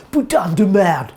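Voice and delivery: hammed-up angry French swearing; angry cursing. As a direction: curse in French, acting out her outburst. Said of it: "crying and cursing".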